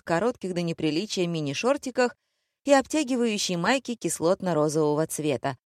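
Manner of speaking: ordinary delivery, nothing marked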